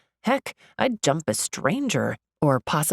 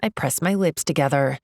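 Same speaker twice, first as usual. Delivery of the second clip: usual speaking voice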